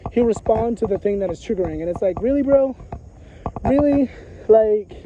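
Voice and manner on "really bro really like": exasperated really, bro; exasperated disbelief. Retold or imitated. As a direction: hit each really harder, fed up. Exasperated at his reply.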